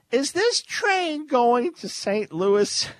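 high, slow, oblivious question; clueless questioner. Retold or imitated. Dim traveler asking beside the train, cluelessly high and slow. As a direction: ask it loudly, drawn out, missing the obvious. Voices the stupid question asker.

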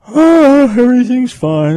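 hammed-up breezy shrug of a voice; mock complacency. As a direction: toss it off like nothing matters, in a big high voice. Mocking a complacent believer.

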